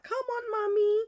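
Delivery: very high little pleading voice; birds' voice. Retold or imitated. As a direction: beg like a tiny bird wanting Mummy. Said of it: "and they're like".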